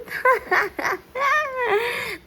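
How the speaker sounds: delighted laugh, high. Real, not acted. Baby sings, parent laughs.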